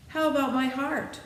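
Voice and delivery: the Tin Woodman pressing his wish; lightly voiced Tin Woodman, hopeful. A narrator's reading.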